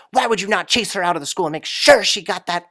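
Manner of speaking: high, loud mock outburst of rage; flipped out. Manager flipping out at the teacher, loud and high. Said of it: "she just flipped out".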